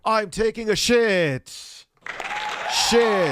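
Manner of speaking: loud, high, drawn-out announcer parody; mocking the narrator. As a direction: bellow it slowly like an overdone voiceover. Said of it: "Why is this guy talking like this?".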